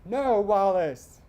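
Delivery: high, loud, drawn-out pleading shout; desperate yelling. A narrator's reading. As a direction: yell like a mother begging her husband to stop. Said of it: "would be dragged along the driveway yelling".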